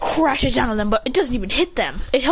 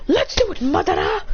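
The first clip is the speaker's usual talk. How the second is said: mild mock yell; 8 semitones higher than usual. Fighter calling on Madara within him, mock yell. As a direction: call out as if yelling, without full volume. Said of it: "then he yells out loud".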